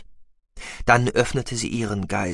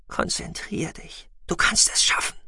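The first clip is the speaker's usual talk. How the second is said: whispering; 8 semitones higher than usual. A narrator's reading. Inner thought to herself.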